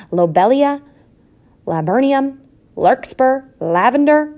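high, loud names with gaps between; roll call. A narrator's reading. Mother takes roll call of her litter, calling out. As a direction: call out each name and pause. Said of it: "she took a roll call".

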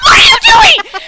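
high, loud mock scream; wife screaming at husband for changing channel; outraged shriek. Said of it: "I was like".

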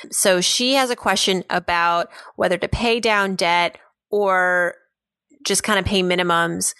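normal talking voice

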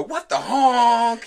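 put-on high, slow little girl squeal; excited child voice. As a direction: squeal it like a delighted kid. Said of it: "She'll go".

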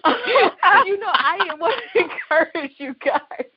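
breaking into laughter mid-sentence; laughing while talking. Real, not acted. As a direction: laugh through the words. Laughing through encouragement.

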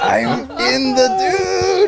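high, drawn-out wail; blissed-out cry. Retold or imitated. Tripper answering friends at the park, high slow blissed-out wail. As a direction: stretch it out, tripping and euphoric.

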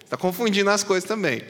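ordinary talk, nothing marked

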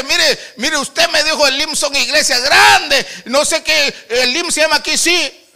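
high, loud retelling of a complaint; full-force preaching. Retold or imitated. Preacher retelling a visitor questioning his small church, full-force preaching. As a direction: preach the visitor's challenge out loudly.

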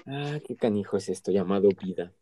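usual speaking voice

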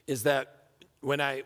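ordinary delivery

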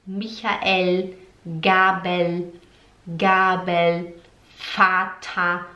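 Michael, Gabel, Vater said slowly; German demo. Shown as a demonstration. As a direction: model each German word. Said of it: "pegue um espelho e fale". (palavras alemãs devagar)

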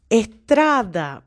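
"estrada" with a long, loud TRA; slow teacher's "estrada", leaning hard on "tra"; stress on TRA. Shown as a demonstration. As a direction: stretch and punch the middle syllable of "estrada". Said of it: "es la sílaba más fuerte".

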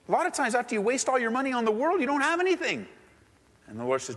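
usual speaking voice

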